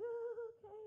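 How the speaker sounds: high, hesitant mock-polite "Oh, okay"; awkward oh okay. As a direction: play it awkward and unsure. Awkward bedroom moment, acted response.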